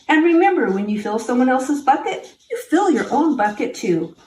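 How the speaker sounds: usual speaking voice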